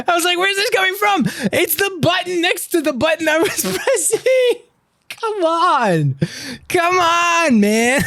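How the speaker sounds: high, squealed protest through laughter; very high, incredulous outburst; laughing exasperation. Real, not acted. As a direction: whine it in amused disbelief. Exasperated at wrong button, laughing.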